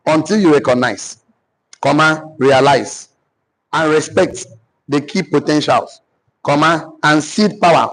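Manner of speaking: slow dictation, phrase by phrase; dictating. Real, not acted. Teacher repeating a statement for note-takers, slow dictation. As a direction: pause after each phrase for the writers.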